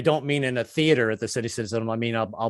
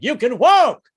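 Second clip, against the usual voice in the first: high, loud healing shout; preacher yell; 10 semitones higher, 9 dB louder, slower than usual. Retold or imitated. Baptist preacher healing a believer, loud high shout. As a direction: belt it like a faith healer. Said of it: "they yell, it's like".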